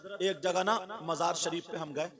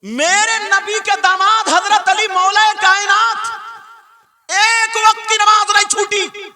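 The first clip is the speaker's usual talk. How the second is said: high, ringing sermon shout; loud, drawn-out declamation; full-force preaching; 13 semitones higher, 15 dB louder, slower than usual. Real, not acted. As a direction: proclaim it to the whole gathering.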